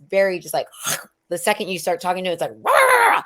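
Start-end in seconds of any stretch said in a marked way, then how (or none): 2.6-3.2 sudden high loud bark of rage; mock angry roar; imitating angry outburst. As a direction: blast it like a defensive man snapping. Angry ex snaps at her.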